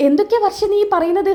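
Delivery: high-pitched disbelief; acted astonishment; shocked questioning. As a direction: question it as if appalled. Acted shock at ending marriage.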